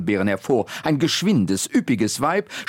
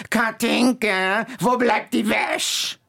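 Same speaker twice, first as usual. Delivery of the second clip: slow, much higher call across the yard; calling old woman; 9 semitones higher, slower than usual. Acted. Old Guschke calling Katinka back to the laundry, slow and high. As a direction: call the girl home like a nagging old woman. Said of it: "trat vors Häuschen und rief".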